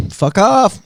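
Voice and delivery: high, slow mock-tough brush-off. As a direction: play it as a cocky threat.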